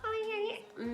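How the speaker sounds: put-on high squeaky childish "Oh, yeah"; baby voice. Mocking Brianna's cutesy voice, high and childish. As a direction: mimic a cutesy high little voice. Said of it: "She's like".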